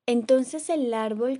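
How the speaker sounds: plain reading voice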